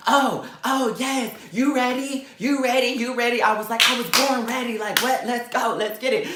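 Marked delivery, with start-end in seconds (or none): 0.1-3.4 high, mocking egging-on; imitated goading rival. 3.9-6.3 high, hyped-up bravado; retold cocky comeback. Answering the rival's challenge.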